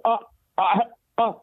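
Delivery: a speechless, floored gasp; stunned stammer. Retold or imitated. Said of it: "my jaw literally drops and I go".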